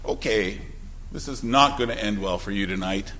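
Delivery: low, quiet, ominous father's warning; a dad calmly promising trouble, voice dropped low; father quietly warning his sassy seven-year-old, low and ominous; stern father warning. Retold or imitated. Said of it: "most fathers would be like".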